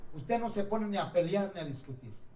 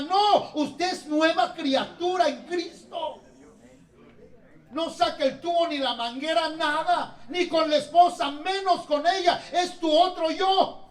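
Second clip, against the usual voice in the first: loud, high, slowing preacher's cry; full-force preaching; 8 semitones higher, 6 dB louder than usual. Acted. Pastor urging the church not to fight, loud and preaching. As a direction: drive it home to the congregation.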